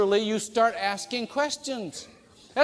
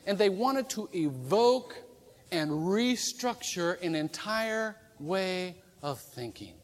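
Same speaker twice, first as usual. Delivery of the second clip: usual speaking voice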